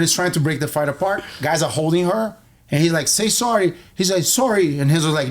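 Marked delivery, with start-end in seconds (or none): 3.1-3.7 higher-pitched mock order; retold command. Cousin pinning the bully, demanding an apology. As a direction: imitate a firm demand, pitched up.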